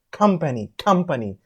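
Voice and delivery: "company" with a puff of air on the opening K; aspirated K. Shown as a demonstration. Teacher demonstrating aspiration, "company" begun with a puffed K. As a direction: push air out on the first sound of "company". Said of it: "while speaking something puff comes".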